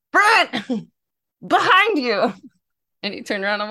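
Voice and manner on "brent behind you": higher, louder call with a laugh, slow on behind you; laughing shout. Retold or imitated. Fan calling to a player who looked the wrong way, laughing shout. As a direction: shout to get his attention, half laughing.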